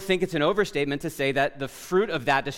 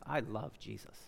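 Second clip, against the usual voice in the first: quiet confession; 6 semitones lower, 14 dB quieter than usual. Shown as a demonstration. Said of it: "need to hear us say".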